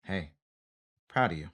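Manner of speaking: low, soft fatherly praise; a gruff dad softening; mock tender pride. Coordinator praising the actor after takes, softly. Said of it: "they're like".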